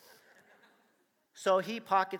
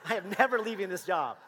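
loud, high, laughing glee; telling his wife, loud and laughing; thrilled; 12 semitones higher, 14 dB louder than usual. Retold or imitated. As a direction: gush with delight. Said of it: "And I'm like".

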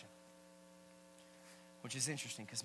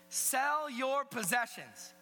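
slow, raised preaching voice; proclaiming scripture; 12 semitones higher, 7 dB louder, much slower than usual. Acted. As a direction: declare the command slowly to the whole room. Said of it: "Jesus says that".

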